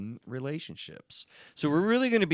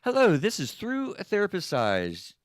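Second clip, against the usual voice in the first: podcast host intro; 10 semitones higher, 4 dB louder than usual. Acted. Official show opening.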